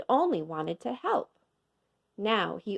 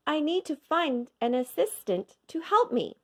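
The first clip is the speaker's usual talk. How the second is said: higher, harried; flustered cook; 7 semitones higher than usual. A narrator's reading. Cook explains to the crowd, higher and harried. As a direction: sound overwhelmed, higher. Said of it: "he said".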